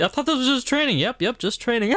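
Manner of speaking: high, bewildered voice of a hurt soldier; wounded soldier gasping. Acted. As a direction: squeak it out in shocked disbelief. Acting a shot soldier dummy.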